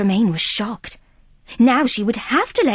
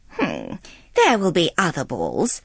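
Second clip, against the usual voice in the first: low, smug stepmother voice; a lowered, self-satisfied stepmother; 6 semitones lower than usual. Acted. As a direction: purr it with cold satisfaction. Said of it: "told her smugly".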